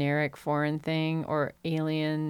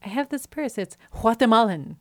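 higher-pitched obnoxious student, overpronouncing Guatemalan; obnoxious college student; 5 semitones higher, 5 dB louder than usual. Retold or imitated. Quoting a sketch's college student about a purse, obnoxious overpronouncing voice. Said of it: "like an obnoxious, like, college student".

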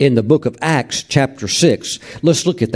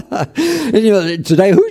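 talking through a real laugh, high then settling; 7 semitones higher than usual. Real, not acted. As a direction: start high in laughter and settle back into speech. Still laughing about the old typewriter.

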